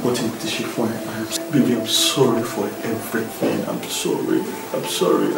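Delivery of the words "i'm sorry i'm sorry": breathy whispered pleading, barely voiced; whispered apology. Real, not acted. Husband whispering sorry to his upset wife. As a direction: whisper it close, begging forgiveness. Husband begs wife's forgiveness.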